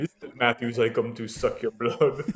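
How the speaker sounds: lightly hammed-up Transylvanian vampire accent. Joking to cohost, Feeney as mild mock Transylvanian vampire. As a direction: play Feeney as a mock Dracula. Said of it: "he's Transylvanian".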